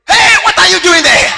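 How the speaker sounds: loud accusing shout at the whispering pair; jealous shout. Retold or imitated. Jealous husband catches his wife whispering, shouting. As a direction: bark it out like a jealous spouse.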